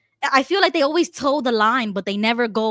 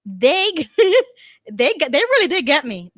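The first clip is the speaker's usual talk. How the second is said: laughing; 6 semitones higher, 4 dB louder than usual. Retold or imitated. Laughing at how she was fooled.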